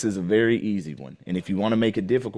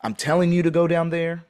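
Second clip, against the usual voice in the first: higher-pitched mock command; giving an order; 6 semitones higher, 4 dB louder than usual. Shown as a demonstration. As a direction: say it as a direct order. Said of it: "Is someone telling me?".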